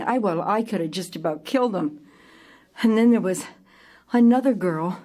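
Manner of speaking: usual speaking voice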